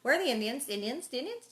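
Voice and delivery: put-on pestering child. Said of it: "She is like".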